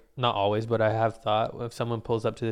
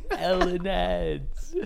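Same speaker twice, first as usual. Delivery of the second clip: silly drawn-out voice; 7 semitones higher, much slower than usual. Pretend. Repeating joke in silly voice.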